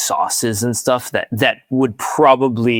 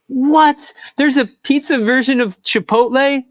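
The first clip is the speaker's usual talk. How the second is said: high-pitched incredulous listener; mock disbelief; 13 semitones higher than usual. Host voicing listeners shocked by pizza Chipotle, mock disbelief. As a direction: squeal it like an astonished listener. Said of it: "for those of you being like".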